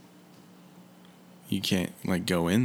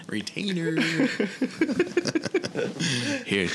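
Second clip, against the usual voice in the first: laughing; 3 dB quieter than usual. Acted. Echoing quote through laughter.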